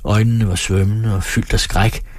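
plain reading voice

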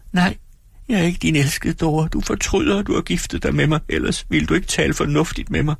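meget høj, såret pigestemme; såret bebrejdelse. Acted. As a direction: kast bebrejdelsen ud grædefærdigt. Dora bebrejder manden grædefærdigt. (Dora anklager sin mand, højt og såret)

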